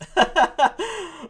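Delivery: high-pitched burst of laughter; high, amused cackle. Real, not acted. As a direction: laugh at a silly joke. Laughs at the retold joke.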